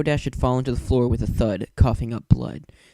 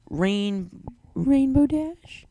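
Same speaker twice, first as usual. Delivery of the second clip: high, slow, broken call of a name; a timid pony sobbing a friend's name; sobbed name; 12 semitones higher, much slower than usual. A narrator's reading. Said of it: "she sobbed".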